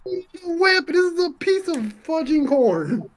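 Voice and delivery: high-pitched, indignant objection; indignant protest. Acted. Character protests the weapon warning.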